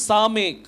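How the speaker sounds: சாமேக் begun with an "s" sound; s pronunciation. Shown as a demonstration. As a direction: model சாமேக் as "saa-mekh", long vowels. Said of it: "இதனுடைய உச்சரிப்பு வந்து". (சாமேக்-ஐ "ஸ" ஒலியுடன் உச்சரித்து)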